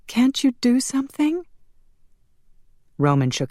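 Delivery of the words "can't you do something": high, desperate appeal; tearful pleading. A narrator's reading. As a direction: beg for help, pitched high. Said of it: "She turned a tearful face".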